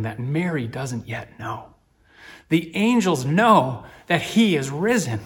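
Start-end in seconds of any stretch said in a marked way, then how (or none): 2.5-5.2 preaching with force. Real, not acted. Preacher building to emphasis.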